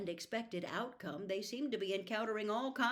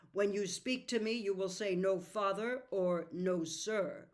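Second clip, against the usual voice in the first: harsh; 6 dB louder than usual. Acted. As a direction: lay down the law. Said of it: "Mister Murray's voice became harsh".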